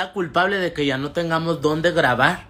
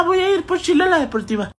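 high, put-on voice of mom on the phone; imitating mom; 13 semitones higher than usual. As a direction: mimic mom announcing her plan, pitched up. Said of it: "Y me dijo, ah". (imitando a la mamá con voz aguda)

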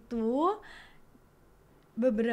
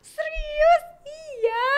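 high, loud excited outburst, re-enacted; excited squeal; 10 dB louder, much slower than usual. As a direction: squeal it like you can't believe it. Retelling her excited reaction on a call.

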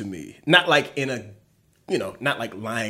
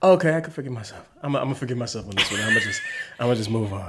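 fast, hurried self-reassurance; rushed self-talk; much faster than usual. Retold or imitated. S1 imitating herself talking herself into moving on, fast. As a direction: rush through forgiving yourself. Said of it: "And a lot of like".

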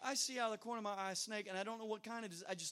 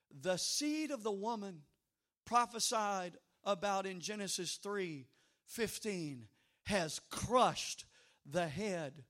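preaching; 4 dB louder than usual. Acted. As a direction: declare it from the pulpit, drawing out the key words. Opens sermon declaring victory.

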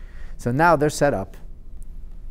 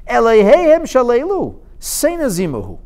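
emphatic king; 9 semitones higher, 6 dB louder than usual. Acted. King's emphatic verdict quoted.

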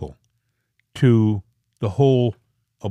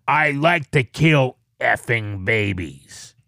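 devilish growl; 2 semitones lower, slower than usual. Retold or imitated. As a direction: growl it out like Satan himself. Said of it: "it sounded like Satan was saying it".

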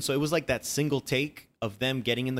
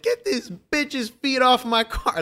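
higher, slow mock rage; angry yell; 12 semitones higher, 4 dB louder, slower than usual. Man losing it over feet on his car, angry yell. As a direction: bark it like a furious stranger. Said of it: "He went, ... he was losing it".

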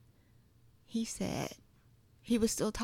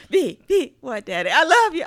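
loud, high imitation of dad; mock-yell it down the phone before hang-up; insistent, affectionate shout, played for laughs; calling out; 6 semitones higher, 11 dB louder than usual.

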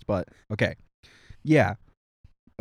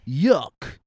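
put-on British accent; 4 semitones higher than usual. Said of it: "I forgot the accent".

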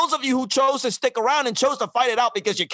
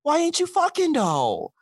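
mocking, demanding partner; 5 semitones higher, slower than usual. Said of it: "be like".

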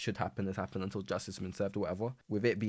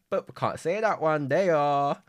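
higher and louder, playfully withholding; loud teasing singsong; 7 semitones higher, 9 dB louder, slower than usual. Pretend. Podcaster teasing listeners about a secret plan, loud and playful.

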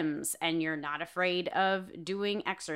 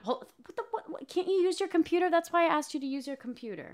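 exasperated; 7 semitones higher, much faster than usual. Real, not acted. Exasperated fast questions.

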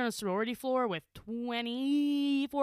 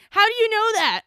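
incredulous question; 9 semitones higher, 11 dB louder than usual. Retold or imitated. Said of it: "I was like".

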